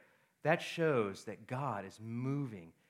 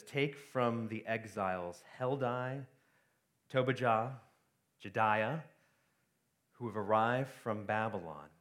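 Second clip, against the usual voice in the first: scripture reading; 4 semitones lower than usual. Acted. Reading the scripture passage aloud.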